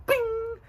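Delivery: high ringing "bing"; ping like an idea popping up; imitated bing. Light bulb moment for a new idea, imitated high bing.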